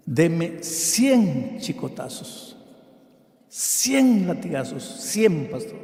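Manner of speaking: slow drawn-out begging, stretched on key words; imitating a desperate drunk; impassioned plea. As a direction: plead slowly for punishment. Drunkard begs pastor to punish him.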